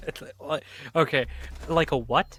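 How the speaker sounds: puzzled what?; confused. Real, not acted. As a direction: caught off guard by the phrase. Confused by odd analogy.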